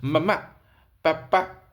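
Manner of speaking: मम्मा, पापा as two short separated syllables each; baby bisyllables. Retold or imitated. Teacher showing bisyllable sounds, मम्मा, पापा in baby syllables. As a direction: say मम्मा, पापा like first baby words, pausing between. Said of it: "बाईसिलेबल sound बोलना शुरू करता है".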